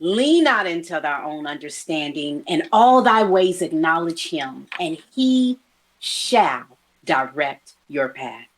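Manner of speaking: low, slow, solemn verse; closing benediction; scripture recitation. Real, not acted. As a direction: recite it like a blessing.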